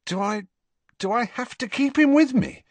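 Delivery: high, rising dismay; aghast. Acted. Harry asking Dumbledore about keeping Kreacher, aghast. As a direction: recoil at the idea. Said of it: "Harry asked aghast".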